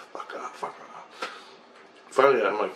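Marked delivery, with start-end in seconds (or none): 0.1-1.3 slow, breathy fuck off; hissed refusal. Retold or imitated. Refusing the aliens' repeated call, hissed under breath. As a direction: push them away under your breath.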